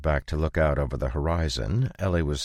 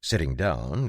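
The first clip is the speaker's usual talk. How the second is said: ordinary delivery, nothing marked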